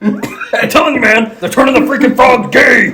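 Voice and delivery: high, worked-up ranter; high conspiracy-host tirade; Alex Jones. Acted. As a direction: pitch it up and rant insistently. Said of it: "He's like".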